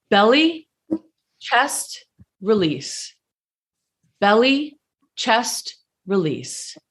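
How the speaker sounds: paced cues, "release" sinking like an exhale; gentle breathing cue. Shown as a demonstration. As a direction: lift "Belly" and "chest", let "release" drop unforced. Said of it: "the release is not a push".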